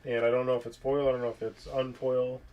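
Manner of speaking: ordinary talk, nothing marked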